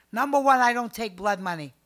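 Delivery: stating her rules to the audience; firm declaration. Real, not acted. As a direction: lay down the law. Firm statement of principles.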